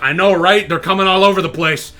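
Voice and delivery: loud, over-keen agreeing; mock agreement. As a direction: agree loudly, playing along. Acted mock agreement with the insulter.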